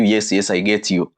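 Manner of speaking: ordinary talk, nothing marked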